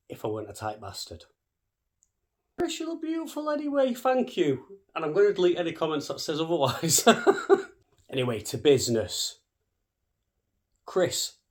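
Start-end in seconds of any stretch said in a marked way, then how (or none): 2.6-7.1 much higher, mock-flattering falsetto; silly high voice. Complimenting himself in silly voice. 7.1-7.7 squeaky burst of laughter; high giggle. Real, not acted. Laughing at own silly voice.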